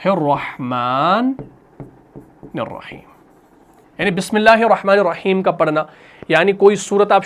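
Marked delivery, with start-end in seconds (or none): 0.0-3.1 slow, drawn-out Quranic recitation; measured tajweed chanting. Shown as a demonstration. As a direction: recite it slowly, stretching each word. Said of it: "بسملہ ہو گیا".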